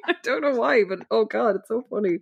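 usual speaking voice

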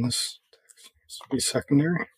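soft unvoiced whisper to himself; whispered to self. Real, not acted. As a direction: whisper it under your breath while working. Muttering to self while editing.

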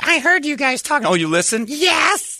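high cartoonish mock voice. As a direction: play the other person in a high silly voice. Acted bar owner exchange.

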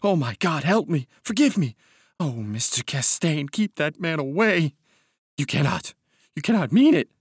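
high, desperate begging that swells in bursts; anguished pleading. A narrator's reading. Broken man begs Mister Castaigne for help, anguished pleading. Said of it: "crying and gasping".

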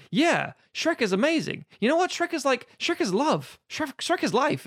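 high, gushing fan voice; lightly voiced, over-eager fan; mock Shrek superfan. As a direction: rave about Shrek like an obsessed fan. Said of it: "And then something".

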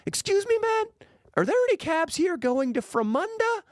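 very high squeaky put-on innocence; high mock voice. Cameraman asks the taxi guy for a ride, in a high squeaky put-on voice. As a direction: play dumb in a high silly voice. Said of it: "be like".

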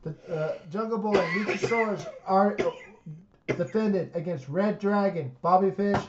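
ordinary talk

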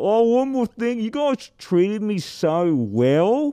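put-on high, slow drunk thank-you; town drunk. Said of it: "and he'd be like".

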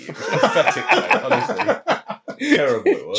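pathetic, said half laughing. Real, not acted. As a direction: stretch the words through a laugh. Laughing at own failure.

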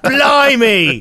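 high-pitched British caricature; mock British accent. Showing how the English sound, in a mock British accent. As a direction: exclaim it in a silly British accent. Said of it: "exactly what you guys sound like".

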